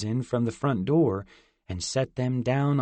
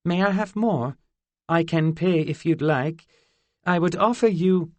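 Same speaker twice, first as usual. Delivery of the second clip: higher, eager fairy asking politely; eager fairy girl; 5 semitones higher, 4 dB louder than usual. A narrator's reading. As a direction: ask for more, eager but polite. Zinnia asking for more.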